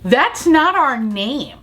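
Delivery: high, slow, drawn-out mock realization; retold disbelief. Woman in the group spots the scooter plaque, mock disbelief. Relative points out wrong scooter.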